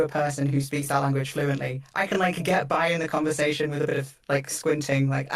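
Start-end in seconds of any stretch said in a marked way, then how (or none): none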